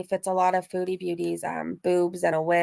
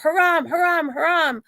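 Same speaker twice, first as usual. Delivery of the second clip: higher-pitched mimicked shout; retold mock shout; 8 semitones higher than usual. Catcaller to women outside clubs, mock shout. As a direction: imitate him calling out, raised pitch. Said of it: "like, shout".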